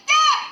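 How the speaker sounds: high-pitched pelted yelp; hammed-up squeal of being hit; imitated high cry of alarm; mock scream. Girl pelted again in meme.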